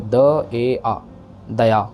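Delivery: दया built slowly from द, ए, आ, then said whole; syllable by syllable. Shown as a demonstration. Teacher spelling out the sounds of दया for students. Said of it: "दद्दा ईड़ी सेहारी ए".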